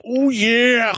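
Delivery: high, loud "Oh yeah!" wrestler shout; Macho Man Randy Savage. Retold or imitated. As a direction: hype it like a wrestling promo. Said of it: "them saying Macho Man shit".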